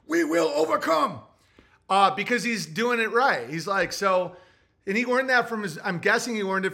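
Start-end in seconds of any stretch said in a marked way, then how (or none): 0.1-1.2 mock activist. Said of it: "I'm like".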